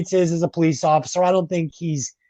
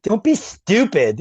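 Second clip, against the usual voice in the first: put-on Surge's accent; 6 semitones higher, slower than usual. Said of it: "these lines are delivered".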